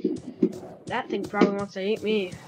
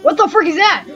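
panicked yelp at a monster; scared shout; 5 semitones higher, 12 dB louder than usual. Real, not acted. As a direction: shout it in sudden fright.

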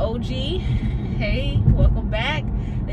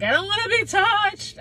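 mock whiny protest; 12 semitones higher than usual. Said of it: "I was like".

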